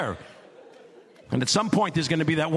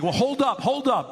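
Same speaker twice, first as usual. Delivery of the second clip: mock pleading deer; 6 semitones higher than usual. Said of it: "he's like".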